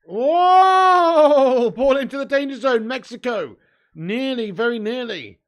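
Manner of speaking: loud, high burst of match excitement; excited shout. Real, not acted. Streamer yelling at a near miss, loud and excited. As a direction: yell as the ball flies into the box. Live goalmouth chance, shouting.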